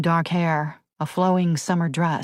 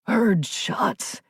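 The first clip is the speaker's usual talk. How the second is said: gasped; 4 semitones higher than usual. A narrator's reading. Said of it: "Bradley gasped".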